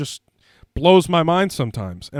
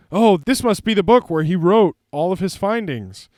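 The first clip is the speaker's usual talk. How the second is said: higher, lightly dramatized movie line; acted excited discovery; 5 semitones higher, 4 dB louder than usual. Frederick finding the secret book, mildly excited. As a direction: play a mild eureka moment.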